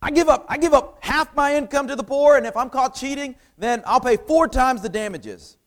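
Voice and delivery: high, loud overwhelmed pledge; acted eager amends, pitched high; apologetic outburst. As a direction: burst out of the stammer into a pleading promise. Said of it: "he starts stammering apologetically".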